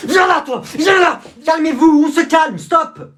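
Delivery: high, loud scuffle yells; courtroom brawl shouting. Acted. Dad and lawyer shouting in a courtroom scuffle. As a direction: shout over the fight.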